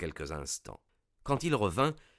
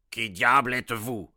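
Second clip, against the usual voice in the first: booming demand from the dark crypt; thundering; 3 semitones higher, 6 dB louder than usual. A narrator's reading. Furious baronet thundering at the intruders in his crypt. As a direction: roar it at the intruders. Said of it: "tonna-t-il".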